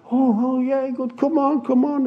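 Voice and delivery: high, bright-eyed eager greeting; eager welcome. Retold or imitated. Healed old man calls visitors in, eagerly excited. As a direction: call them in, thrilled to see them.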